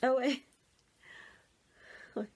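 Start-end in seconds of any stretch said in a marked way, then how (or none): none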